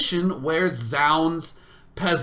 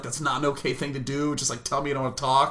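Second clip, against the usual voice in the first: ordinary talk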